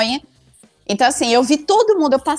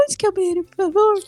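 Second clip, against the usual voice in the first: higher, sugary, polite plea; cute sweet asking; 8 semitones higher, faster than usual. Shown as a demonstration. Asking the librarian for the toilet, sweetly high. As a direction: sweeten it up like a shy girl. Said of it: "Tipo, fofa".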